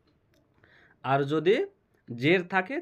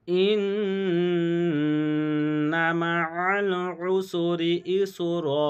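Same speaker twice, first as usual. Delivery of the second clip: slow melodic chant with long ghunna on ইন্না; Quran recitation; 2 semitones higher, much slower than usual. Shown as a demonstration. Teacher reciting the whole verse for students. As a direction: recite the verse slowly and tunefully. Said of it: "টান সহ পড়তে হবে".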